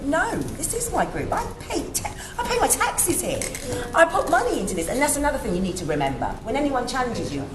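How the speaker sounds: usual speaking voice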